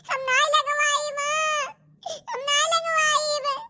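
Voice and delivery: slow, sobbing no to the needle; crying refusal. Acted. Scared bride sobbing to the doctor, slow crying refusal. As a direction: cry and refuse the shot, slowly. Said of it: "बाप रे बाप ... सुई से डरात हैं".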